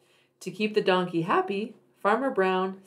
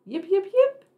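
high, loud yipping fox call; 11 semitones higher, 5 dB louder than usual. Acted. Little fox on the hill calling out loud and high. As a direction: yip it out high like a fox pup.

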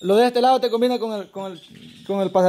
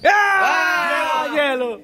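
excited shout; 6 semitones higher, much slower than usual. Real, not acted. Cheering the winner's score.

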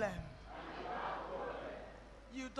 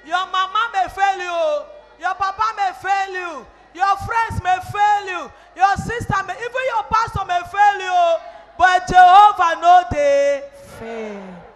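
loud, raised preacher's build-up; emphatic sermon cadence, loud throughout; forceful preaching; 5 semitones higher, 20 dB louder than usual. Real, not acted. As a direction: hammer out each clause to the congregation.

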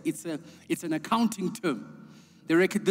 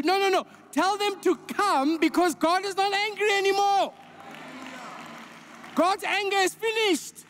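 much higher, urgent preaching climax; full-force preaching; 8 semitones higher than usual. Real, not acted. Preacher urging the church to tell the world, full-force preaching. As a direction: lift it up and drive it home.

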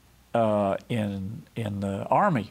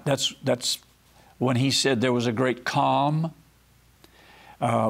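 normal talking voice

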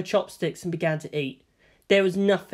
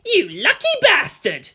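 envious shout; 12 semitones higher, 6 dB louder than usual. A narrator's reading. Kensuke keeps shouting.

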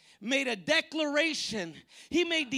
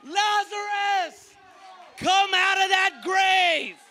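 commanding shout; 3 semitones higher, slower than usual. Acted. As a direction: summon the dead from the tomb. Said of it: "He said".

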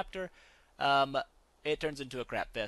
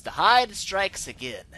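mock doom; 4 semitones higher, 10 dB louder, slower than usual. Real, not acted. Mock dramatic game complaint.

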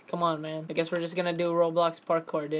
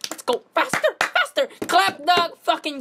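high, loud, frantic "faster"; frantic urging; 9 semitones higher, 5 dB louder than usual. Real, not acted. Gamer yelling at a teammate to hack faster, frantic. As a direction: yell at your teammate to hurry.

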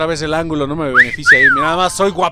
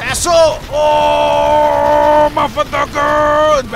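high, loud, drawn-out yell of triumph; exultant shout; 8 semitones higher, 7 dB louder, much slower than usual. Real, not acted. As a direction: scream with glee. Exultant shouting during boss hit.

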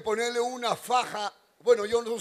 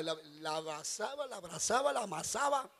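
sly hushed telling; 8 dB quieter than usual. Acted. Suggestive lowered telling.

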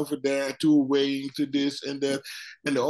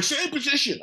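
mock referee order; 13 semitones higher than usual.

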